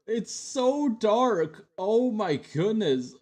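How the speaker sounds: high, slow, stretched-out disbelief; surprised. Real, not acted. Creator rewatching old video, surprised at the dark filter. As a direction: gawk at it in real surprise.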